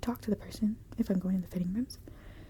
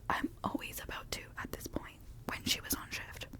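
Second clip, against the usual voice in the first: ordinary talk, nothing marked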